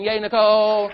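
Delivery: loud high cheer for Nicole. Real, not acted. Presenter leads applause for volunteer Nicole, loud cheer. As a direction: whoop it up for Nicole.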